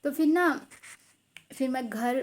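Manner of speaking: normal talking voice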